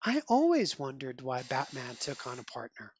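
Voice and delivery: low, soft, knowing Catwoman line; a hint of sly admiration, kept low; lightly voiced Catwoman. Catwoman praising Robin, low soft knowing read. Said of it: "Catwoman endorsing him".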